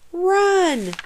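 high, drawn-out yell; screamed. A narrator's reading. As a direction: scream the warning, high and long. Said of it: "Nate screamed".

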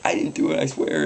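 mock crying plea; a hint of tearful begging; retold crying. Girl pleads with the teacher, mock tearful. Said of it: "she started crying".

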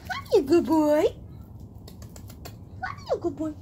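high-pitched hello to a dog. Real, not acted. Greeting the dog.

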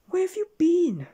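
high, loud call across the garden. A narrator's reading. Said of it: "she called out".

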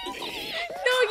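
high panicked shriek. Real, not acted. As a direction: squeal it in horror. Grito agudo al ver la escena. (chillido agudo de pánico)

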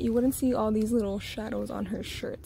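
ordinary delivery

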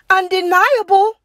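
proud penguin. A narrator's reading. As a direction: admits it without blinking. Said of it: "said Penguin".

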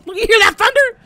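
loud, high interruption; startled exclamation. Real, not acted. Gamer interrupting recap for thunder, startled high yell. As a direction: break off and yelp the question.

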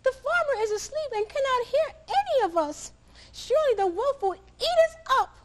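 high, fretful hen voice; alarmed chicken. Acted. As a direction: worry aloud like a hen. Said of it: "the chicken clucked in alarm".

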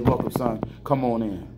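soft, low welcoming voice as God; gentle welcome. Retold or imitated. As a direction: quietly welcome a son home. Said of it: "I pray he says".

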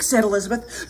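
ordinary delivery, nothing marked

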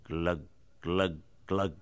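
low, soft glugs with gaps between; deep, quiet draining noise; glugging water. Acted. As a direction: imitate water gurgling down a plughole. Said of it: "the last of the sea going".

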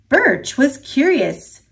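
usual speaking voice

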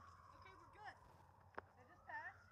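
soft, half-whispered all clear; hushed. Real, not acted. Friend hiding from ambulance, hushed relief. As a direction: whisper that it's safe.

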